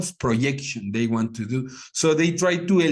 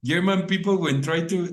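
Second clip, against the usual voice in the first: normal talking voice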